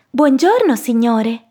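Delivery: high, singsong piglet greeting; high-pitched piglet voice; polite little pig. Acted.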